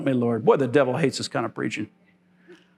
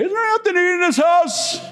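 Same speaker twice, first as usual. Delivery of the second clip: mock complaining old man, much higher; whiny old man; 14 semitones higher than usual. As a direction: ham up a cranky old man. Preacher mimics himself as old.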